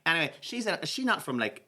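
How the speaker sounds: ordinary talk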